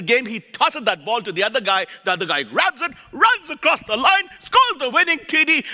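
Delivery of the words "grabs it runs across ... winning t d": excited play-by-play. Acted.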